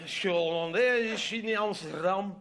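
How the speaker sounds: exaggerated, drawn-out Flemish-accented French; thick Flemish accent. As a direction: ham up the Dutchman's Flemish accent. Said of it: "en français avec un accent flamand". (accent flamand caricaturé et traînant)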